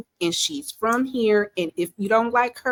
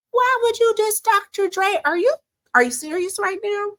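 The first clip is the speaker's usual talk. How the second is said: incredulous; 11 semitones higher than usual. Real, not acted. Indignant at a diss on [name].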